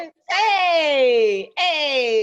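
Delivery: loud cheering hey. Real, not acted. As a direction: shout it out while toasting. Toasting on camera, shouting.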